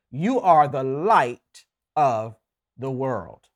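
very slow, every word weighed; emphatic quote. Acted. Pastor quoting Jesus to the congregation, slow and deliberate. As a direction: lay the verse down word by word. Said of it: "And Jesus is saying here".

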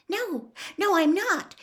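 high, hurried denial; hasty. A narrator's reading. Hermione denying Ron's accusation, high and hasty. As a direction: jump in with a quick, high-pitched protest. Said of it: "she said hastily".

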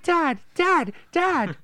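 put-on very high, loud child calling out; mock whiny kid. Son calling his dad over and over, mock kid voice.

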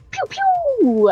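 high toy-gun pew noises; pew pew sound effect. Retold or imitated. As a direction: zap out cartoon blaster sounds.

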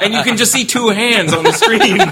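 high, loud, cracking up while talking; laughing. Real, not acted. Storyteller cracking up over the hands on screen, laughing. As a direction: push the words out through real laughter.

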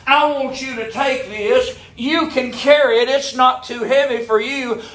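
impassioned prayer voiced from the pulpit; fervent prayer. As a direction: cry out to the Lord to take the burden. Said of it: "say, "Lord".

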